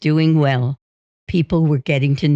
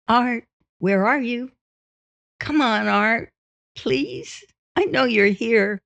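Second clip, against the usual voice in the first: imitated wife calling out; 7 semitones higher than usual. Wife calling to husband hiding in the closet, high imitated call. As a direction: call pleadingly in a high mock-female voice. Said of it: "she'd shout".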